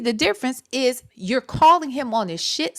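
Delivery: ordinary talk, nothing marked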